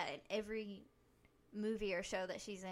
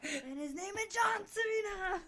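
high, loud, drawn-out announcer shout; mock wrestling announcer; 9 semitones higher, 6 dB louder, slower than usual. Podcast host parodying a wrestling intro, loud announcer hype. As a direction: hype a wrestler's entrance at full volume.

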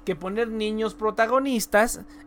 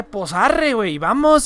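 loud norteño imitation; northern Mexican accent; 9 dB louder, slower than usual. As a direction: mimic a northern Mexican speaker. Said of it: "así hablaban".

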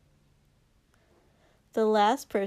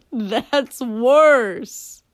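laughing loudly; 3 semitones higher, 9 dB louder than usual. Acted. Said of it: "started laughing very loudly".

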